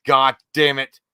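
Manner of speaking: loud retold curse from dad; dad cursing. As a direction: bark it like an annoyed dad.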